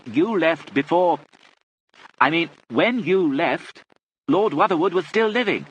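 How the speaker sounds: loud, high, drawn-out words; speaking up for the deaf. A narrator's reading. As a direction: hammer each word out for a deaf listener.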